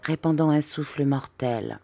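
ordinary delivery, nothing marked

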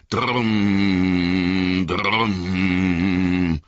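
drums rolling up from the dark; booming war drums. A narrator's reading. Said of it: "dröhnten die Trommeln".

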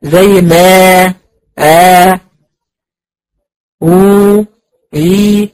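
آ، أو، إي each drawn out slowly from the throat; held madd vowels, slow and resonant; long vowel demo. Shown as a demonstration. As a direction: sustain آ then أو then إي with no consonant contact. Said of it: "الحروف المادية بتطلع من الجوف".

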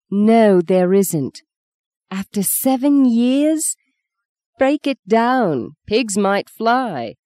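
drawn-out disbelief; scoffing. Acted. Scoffs at marrying Rain.

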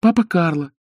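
put-on high, eager puppet boy; wooden boy voice. A narrator's reading. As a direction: pipe it like a small boy.

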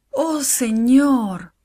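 slow, high plea to God; drawn-out, imploring cry of prayer; praying. A narrator's reading. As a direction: draw it out as a heartfelt prayer. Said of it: "oró ella".

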